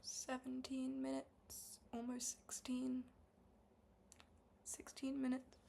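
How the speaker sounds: slow whispered countdown to herself; drawn-out whisper. Real, not acted. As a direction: whisper the minutes slowly, checking the timer. Reading the timer to herself.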